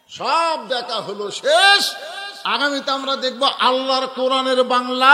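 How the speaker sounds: loud drawn-out proclamation; rallying shout. Real, not acted. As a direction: rouse the crowd, punching the last word. Rallying the congregation.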